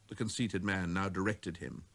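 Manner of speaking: plain reading voice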